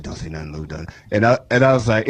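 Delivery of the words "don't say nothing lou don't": much lower and much softer warning; mock hushed warning. Tells his buddy to stay quiet, low and hushed. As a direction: hush him down low. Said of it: "ladies came up to the car and said".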